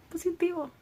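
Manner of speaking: high, soft and stunned; reading it out softly. Retold or imitated. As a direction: read the word in quiet shock. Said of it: "y sí, decía". (en voz baja, atónita)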